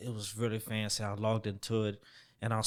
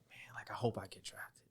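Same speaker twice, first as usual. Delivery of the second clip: a hushed, unvoiced whisper of nervous hope; 11 dB quieter than usual. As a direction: whisper it to yourself like you're afraid to jinx it. Nervous inner thoughts, whispered.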